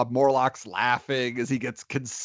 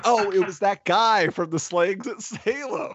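mildly exclaimed, higher-pitched recognition; retold sudden realization; 8 semitones higher than usual. Film fan recently recognizing an actor from an old movie, mock surprised realization. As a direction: act out the penny dropping, pitch lifted.

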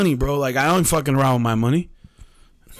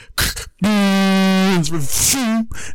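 long sputtering machine noise; imitated AC noise; 5 semitones higher than usual. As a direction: mimic an air conditioner spitting out dust. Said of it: "it shot out a fucking spurt of dust".

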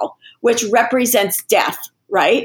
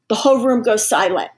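ordinary talk

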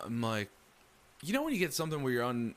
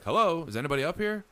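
raised call into the dark, a little fast; 6 semitones higher, 3 dB louder, faster than usual. Retold or imitated. Alone upstairs, calling into the empty floor, raised voice. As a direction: call out to see if anyone is there.

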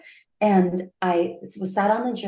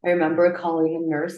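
ordinary talk, nothing marked

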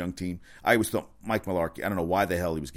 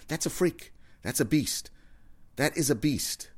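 much higher, hyped praise; excited hype; 8 semitones higher, slower than usual. Real, not acted. Host marveling at Kirk Cousins' numbers, pitched up and excited. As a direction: marvel at him, voice up high. Hyping a player's stats.

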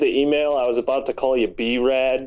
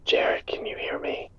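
unvoiced-soft, hushed whisper into the mic; ASMR whisper; 6 dB quieter than usual. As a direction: whisper it as an ASMR sound check. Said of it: "Like, whisper?".

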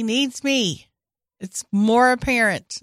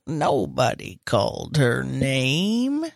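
imitating Donald; 8 semitones lower, slower than usual. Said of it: "And Donald goes".

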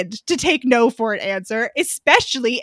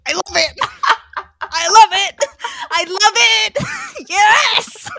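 I love it shouted through laughter, higher; delighted laughing; 8 semitones higher, 5 dB louder, slower than usual. Real, not acted. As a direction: squeal with joy.

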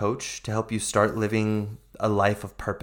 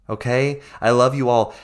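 ordinary talk

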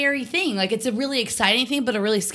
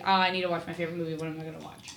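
musing aloud; 3 semitones lower, 8 dB quieter, much faster than usual. Acted. Acts out someone wondering what to watch.

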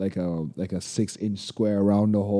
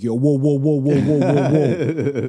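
rapid-fire raised whoas, playful; calling out; 3 semitones higher, 4 dB louder than usual. Retold or imitated. Bystanders jumping into a party fight, calling out in alarm. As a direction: mimic a crowd breaking up a fight, louder.

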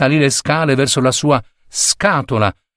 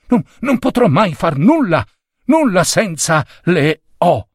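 higher, stammering and panicked; breathless panic; 7 semitones higher than usual. A narrator's reading. Errand boy gasping to his boss, high and breathless. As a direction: blurt it out short of breath. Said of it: "disse annaspando per via del fiato corto".

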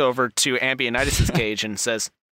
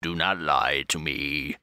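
low, softer cold warning; stern archbishop; 9 semitones lower, 4 dB quieter than usual. Acted. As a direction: warn him quietly and coldly. Archbishop warns prisoner.

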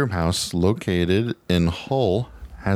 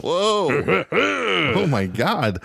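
startled; 11 semitones higher, much slower than usual. Real, not acted. Reacts to ghost music scare.

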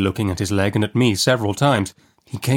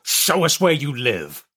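slow, higher-pitched rough demand; menacing convict; 5 semitones higher, slower than usual. A narrator's reading. Man demanding where the boy lives, slow and menacing. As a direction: draw the order out as a threatening convict.

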